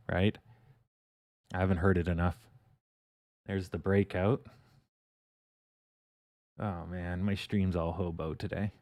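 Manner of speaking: normal talking voice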